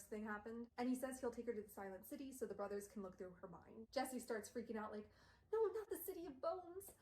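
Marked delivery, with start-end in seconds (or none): 5.5-6.9 high frantic pleading voice; mock terrified plea, much higher; acted panicked begging. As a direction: beg desperately, slowing at the end. Jesse freaking out, begging.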